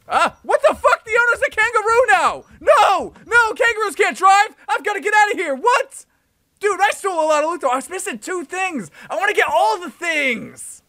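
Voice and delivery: high, loud alarmed outburst; panicked yelling. Real, not acted. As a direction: yell in shock as you flee. Kangaroo owner appears, player panics.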